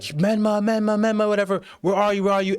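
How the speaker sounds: high, searching calls; calling out. Retold or imitated. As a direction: call out for a lost friend. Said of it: "They're like".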